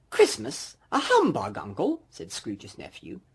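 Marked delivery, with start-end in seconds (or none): 0.1-2.0 high, disbelieving question; can't believe what he just heard; incredulous. Acted. The nephew questioning his uncle's humbug, high and amazed.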